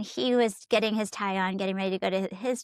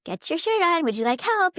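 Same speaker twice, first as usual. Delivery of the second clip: higher, coaxing; prodding parent; 6 semitones higher than usual. Retold or imitated. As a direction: gently push a stalling child. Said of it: "little prodding things like".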